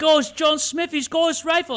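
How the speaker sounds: high, loud mock exclamation; hammed-up character voice. Acting out the movie scene for a co-host, hammed-up and high.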